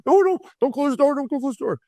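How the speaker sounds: very high, desperate cry; high mock panic, fading at the end; frantic plea. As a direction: beg the agent not to shut the door. Pleading as the gate door closes.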